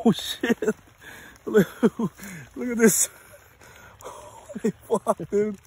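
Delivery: hollering with wild excitement as it fights; excited shouting. Real, not acted. Crab fighting in his hand.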